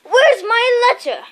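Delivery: high, loud demand. A narrator's reading. Harry demands his letter from Uncle Vernon. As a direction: demand it straight away.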